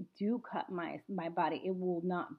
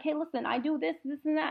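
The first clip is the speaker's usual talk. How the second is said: retold eager pitch; 8 semitones higher, 4 dB louder than usual. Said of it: "and I was like".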